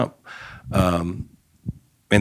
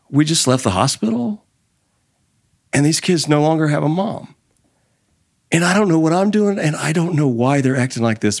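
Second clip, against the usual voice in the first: higher, straining voice of a grieving father; tearful grieving dad; 7 semitones higher than usual. Retold or imitated. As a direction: voice the dad close to tears, pitch climbing. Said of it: "he's got a tear coming in his eye and he says".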